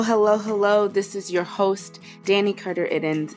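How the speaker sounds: ordinary talk, nothing marked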